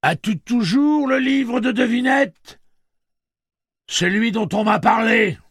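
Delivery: a huge voice asking from above; booming machine voice. Acted. Blaine asks about the riddle book.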